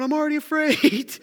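hammed-up high frightened reply; mock fear. Frightened Peter answers the call.

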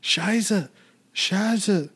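high, hammed-up German "Scheiße."; mock German. Mocking the German-into-English switch in Valkyrie, high hammed-up German. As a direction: growl "Scheiße." like an old war-film German.

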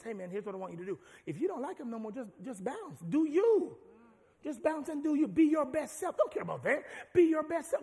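the world's voice. Acted. Preacher voicing the world urging you to leave, loud and high. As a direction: hype them up like a bad friend. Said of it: "what the world will say is".

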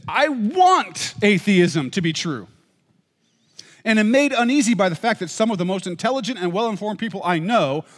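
quoted passage in a lifted reading voice; reading a quote. Acted. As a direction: read it like a frank admission. Reads Nagel quote aloud.

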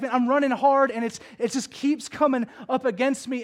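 acted weary protest, forcefully voiced; exasperated. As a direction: vent as if near breaking point. Imagines Paul at his breaking point.

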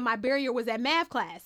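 normal talking voice

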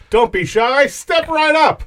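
high, showy barker call; carnival barker. Acted. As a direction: beckon like a fairground barker.

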